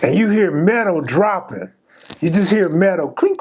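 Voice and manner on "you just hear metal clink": ordinary talk, nothing marked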